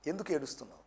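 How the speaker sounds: hushed, inward rereading; quiet reflective repeat. Shown as a demonstration. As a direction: drop the voice to ponder the question. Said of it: "ప్రశ్న చూడండి".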